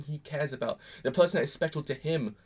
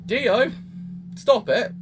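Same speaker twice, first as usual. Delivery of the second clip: higher, louder stop it; exasperated plea; 7 semitones higher, 6 dB louder, much slower than usual. Retold or imitated. Yelling at Roswell on screen, exasperated. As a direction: plead with the character to stop. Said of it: "I was like".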